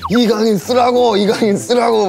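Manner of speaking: high, loud re-enacted fan yell; retold fan shouting. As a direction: demand it like a frustrated supporter at the match.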